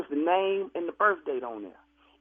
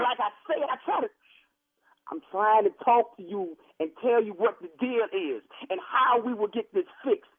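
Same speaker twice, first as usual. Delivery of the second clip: ordinary talk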